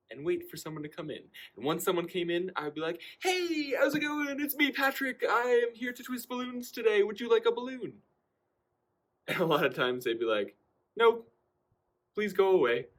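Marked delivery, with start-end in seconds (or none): 3.2-8.0 high, bright salesman voice pitched way up; eager balloon guy. Retold or imitated. Cheerful greeting to restaurant customers. 11.0-12.9 louder, higher curt brush-off; annoyed customer. Retold or imitated. Customers rejecting the balloon twister.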